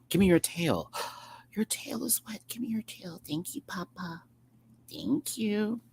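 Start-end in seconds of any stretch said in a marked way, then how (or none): 1.6-5.8 cooing to pet. Real, not acted. Cooing to cat.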